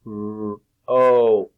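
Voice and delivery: "R" held, "O" loud and long; segmented sounds. Shown as a demonstration. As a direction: take rope apart, "R, O". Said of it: "take apart the sounds".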